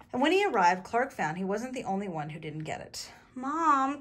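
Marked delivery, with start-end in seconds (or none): 3.3-4.0 loud, high whine for mother; acted child's wail; crying out. A narrator's reading. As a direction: call for a parent in high distress. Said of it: "cried Clark".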